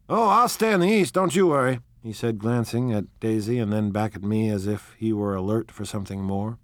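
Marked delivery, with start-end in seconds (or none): 0.1-1.8 cocky man's voice. Acted. Tom's cocky retort.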